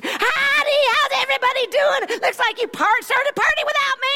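high, bright Texan preacher greeting; mock Cindy Jacobs, high and hearty. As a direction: boom in like a cheery Texan host. Cindy Jacobs voiced entering.

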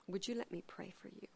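a hushed, caring question; gentle offer. Retold or imitated. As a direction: offer prayer tenderly.

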